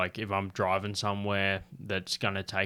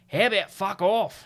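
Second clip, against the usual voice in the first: mock gruff dad, higher and louder, snapping back; gruff old man retort; 11 semitones higher, 7 dB louder than usual. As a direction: play the big bearded dad telling them off. Old man's retort retold.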